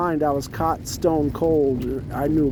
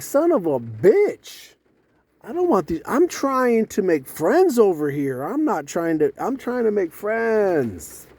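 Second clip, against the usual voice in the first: slow, drawn-out exasperated protest; 4 semitones higher, slower than usual. Retold or imitated. Newcomer trying to make friends, exasperated. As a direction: plead your case, stretching the key words. Acted frustrated protest at being resented.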